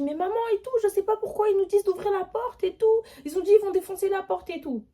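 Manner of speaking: retold panic on the phone; panicked call. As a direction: blurt it all out to the parents.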